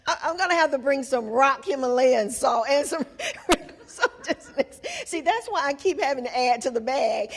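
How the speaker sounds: fast, giggling through it; laughing. Real, not acted. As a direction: joke while still laughing. Joking about bringing salt.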